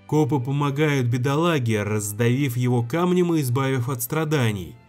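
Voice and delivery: ordinary talk, nothing marked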